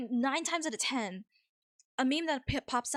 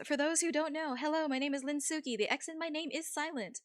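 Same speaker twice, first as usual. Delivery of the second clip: high, sweet streamer greeting voice; chirpy cute welcome for the raiders; cute anime streamer; 5 semitones higher than usual. Acted. Welcoming raiders, introducing herself.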